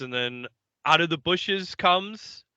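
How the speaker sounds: ordinary talk, nothing marked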